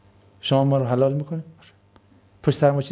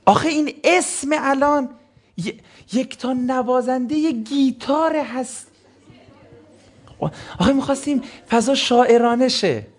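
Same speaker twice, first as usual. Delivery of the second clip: mock host; 7 semitones higher than usual. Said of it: "parody of host's defence".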